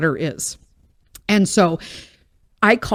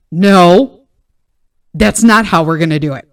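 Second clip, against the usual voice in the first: firm refusal; 2 semitones lower, 6 dB louder than usual. Retold or imitated. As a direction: shut it down hard. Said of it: "And I'm like".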